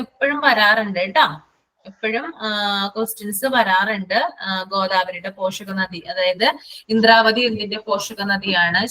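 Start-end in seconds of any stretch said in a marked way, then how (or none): none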